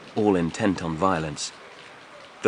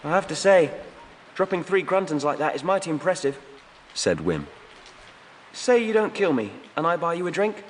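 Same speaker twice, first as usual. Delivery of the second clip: put-on much higher young voice, hesitant; nervous youth; 9 semitones higher than usual. A narrator's reading. Wim thanks his rescuer, high and unsure. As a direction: thank him warily.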